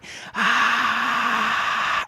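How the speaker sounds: high airy breathy scream; whisper scream. Shown as a demonstration. Coach demoing the whisper scream to students. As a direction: push a whispered scream out on air. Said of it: "let's introduce the whisper scream".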